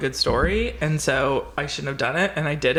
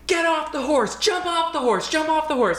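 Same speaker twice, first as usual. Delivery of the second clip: high, frantic imitated warning; urgent yell; 12 semitones higher than usual. Wrangler ordering a scared rider off the horse, urgent high yell.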